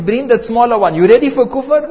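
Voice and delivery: Fir'aun loudly demanding the mother give up her faith; commanding tyrant. Retold or imitated. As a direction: bark the order at her. Fir'aun orders next child brought.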